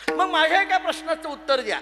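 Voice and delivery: usual speaking voice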